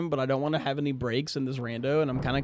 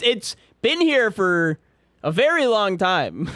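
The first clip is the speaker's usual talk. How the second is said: slow, loud, every word leaned on; emphatic complaint; 5 semitones higher, 5 dB louder, slower than usual. Real, not acted. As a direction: drag it out to make the point. Complains about obvious ad timer.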